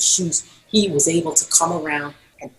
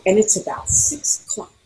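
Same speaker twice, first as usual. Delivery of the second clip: ordinary delivery, nothing marked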